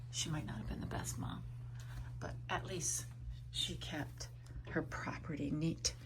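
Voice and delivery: soft unvoiced whisper to the dog; whispering a secret to her dog Dex. Real, not acted.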